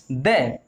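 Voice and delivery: द repeated with a short neutral vowel. Shown as a demonstration. Teacher quizzing students, short duh answer. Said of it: "क्या pronounce करेंगे?".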